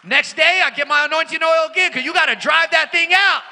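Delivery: loud charged rebuking in Jesus' name; fervent rebuking. Retold or imitated. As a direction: pray like you're driving the devil out. Fervent prayer, rebuking demons.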